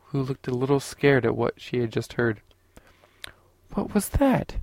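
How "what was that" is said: put-on higher, startled young woman asking; a frightened woman's sudden question; disconcerted. A narrator's reading. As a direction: let her alarm lift the question up high. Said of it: "her voice making it obvious that she was disconcerted".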